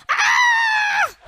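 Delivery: very high-pitched bird screech; piercing mock scream of a parrot; imitated parrot scream. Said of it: "And then screams".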